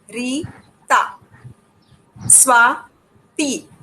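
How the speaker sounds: Ritaswati said in separate beats with pauses; teacher counting out ri ta swa ti; syllable by syllable. Shown as a demonstration. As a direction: chop ri, ta, swa, ti apart for children to count. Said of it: "I'm going to give you a strategy".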